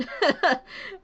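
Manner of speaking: high, loud little laugh; short laugh. Real, not acted. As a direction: laugh briefly, lifting in pitch. Laughs at the half-fixed camera.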